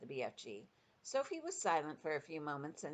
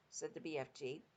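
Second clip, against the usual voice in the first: ordinary delivery, nothing marked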